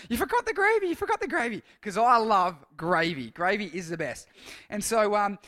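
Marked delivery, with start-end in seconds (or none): 0.1-1.6 much higher sing-song teasing taunt; teasing school friends. Retold or imitated. School friends commenting on his birthday post: higher sing-song teasing. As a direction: mimic schoolmates ribbing him about gravy, much higher and mocking. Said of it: "my friends at school were commenting on it".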